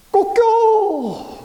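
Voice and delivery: long, high, stretched crow; rooster crow. Acted. Preacher imitating the cock crowing at Peter's denial, a high stretched crow. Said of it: "닭이 웁니다".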